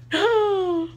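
high sharp gasp of horror; shocked gasp. Retold or imitated. Stylist seeing the botched haircut, a high shocked gasp.

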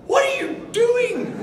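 high, drawn-out yell; exasperated shout. Retold or imitated. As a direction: shout it in loud disbelief. Retold panicked shouting at wife in labour.

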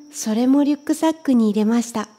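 plain reading voice

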